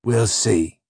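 sceptical, grudging growl. Acted. Spring reserving judgement on Sanchez, growling. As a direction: growl it under restraint. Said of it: "growls Spring".

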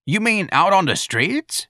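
high, slow, rising disbelief; incredulous question. Acted. Santa questioning Irene about the job, incredulous. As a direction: draw out the shocked question.

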